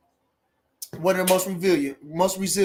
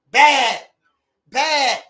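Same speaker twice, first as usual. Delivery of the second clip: hyped shout; 8 semitones higher, 8 dB louder than usual. Real, not acted. Hyping Crawford's skills.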